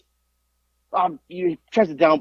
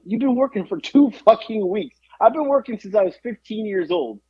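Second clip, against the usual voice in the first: exasperated scolding; 7 semitones higher than usual. Retold or imitated. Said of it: "I was like".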